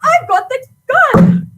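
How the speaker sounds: high, excited shouts through a door; urgent calling out. Acted. Guest with gun calls to the trapped pair, urgent shouting. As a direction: call out urgently at high pitch. Calling to those locked inside.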